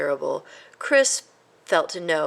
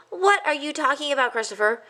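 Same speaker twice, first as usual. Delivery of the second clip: getting angry; 8 semitones higher than usual. A narrator's reading. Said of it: "exclaimed Ced, beginning to get angry".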